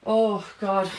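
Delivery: gagging groan; dry heaving. Real, not acted. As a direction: groan through the nausea. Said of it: "I got a little nauseous".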